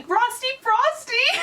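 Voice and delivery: very high, drawn-out squealed chant; mock screaming. Sister imitating brother screaming for a Frosty, high mock scream. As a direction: squeal it like an overexcited kid. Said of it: "you went off screaming".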